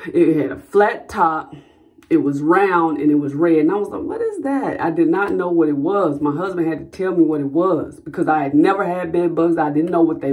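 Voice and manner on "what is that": startled question. Retold or imitated. First sight of a bed bug.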